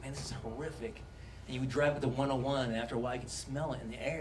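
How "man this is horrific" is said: low, soft, hushed dismay. Retold or imitated. Watching wildfire news, low and hushed in dismay.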